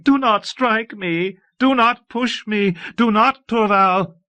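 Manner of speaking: high, loud, drawn-out wail. A narrator's reading. Abbess cowering as Torval raises his arm, wailing. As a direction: cry out slowly at high pitch, cowering from a blow.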